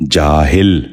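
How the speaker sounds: جاہل said "jaa-hil", with i after the h; short i in the last syllable of جاہل; correct pronunciation. Shown as a demonstration. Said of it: "آپ بھی غور سے سنیے".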